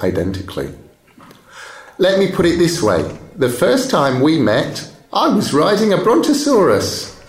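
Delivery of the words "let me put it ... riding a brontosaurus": playful tall tale. Acted. Chloe teases Marcel about her age.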